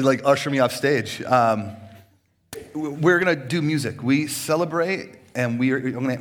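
ordinary talk, nothing marked

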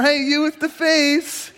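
high, slow, joking hail to a stranger; hammed-up clumsy hello; mock awkward greeting. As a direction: tease the nameless neighbor.

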